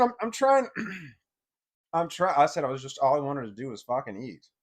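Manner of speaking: normal talking voice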